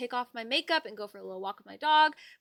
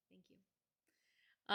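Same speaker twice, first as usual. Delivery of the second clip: whispered thanks; 4 semitones lower, 19 dB quieter than usual. Real, not acted. Thanks repeated breathily.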